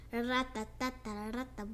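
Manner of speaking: plain reading voice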